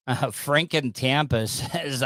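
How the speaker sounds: ordinary talk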